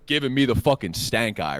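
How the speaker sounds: ordinary talk, nothing marked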